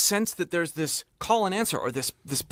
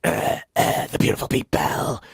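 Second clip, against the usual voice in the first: projected whispered growl; stage whisper; 7 semitones lower than usual. Retold or imitated. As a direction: whisper it hard like a rock singer. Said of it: "[name] do this kind".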